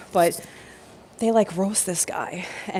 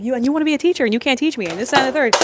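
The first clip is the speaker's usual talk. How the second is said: higher-pitched mock rant; popping off; 6 semitones higher, faster than usual. Apprentice popping off at her would-be teacher, mock angry rant. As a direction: replay an angry outburst, pitched up. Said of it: "I started just popping off".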